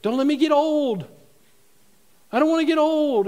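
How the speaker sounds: high, loud imitated wail; mock desperate plea. Preacher mimics people praying against old age, mock desperate plea. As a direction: protest aging with hammed-up dread.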